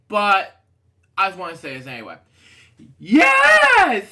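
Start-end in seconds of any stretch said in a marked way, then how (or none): 3.0-4.1 huge, high, squealing yell of joy; hilarious shout. Pretend. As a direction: scream it out as comically as you can. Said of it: "I'm gonna say this hilariously".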